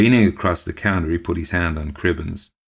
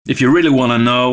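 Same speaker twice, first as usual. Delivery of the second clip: loud, higher, quickened growl; 7 semitones higher, 8 dB louder, faster than usual. A narrator's reading. Local drinker insists on telling the tale, growling. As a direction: growl it out impatiently. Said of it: "Love growled".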